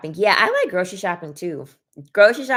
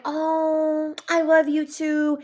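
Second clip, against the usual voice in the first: much higher, hammed-up sugary "Oh, I love you too"; mock sweet reply; 10 semitones higher, much slower than usual. Mocking the boyfriend's reply he never gave, much higher and sugary. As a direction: play it as the cheesy loving boyfriend he isn't. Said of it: "He's not like".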